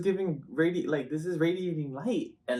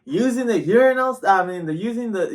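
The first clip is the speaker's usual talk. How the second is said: high, slow, mocking voice of a rude stranger; high scandalised imitation; mocking nosy stranger; 7 semitones higher, 8 dB louder than usual. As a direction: squeal it out like a scandalised busybody. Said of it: "this person was like".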